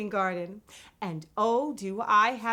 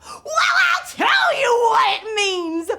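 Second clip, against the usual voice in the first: much higher, louder, giddy host voice with laughs; bubbly Miss Vocab; 11 semitones higher, 8 dB louder than usual. Acted. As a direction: play the over-the-top vocab host. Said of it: "I'm Miss Vocab".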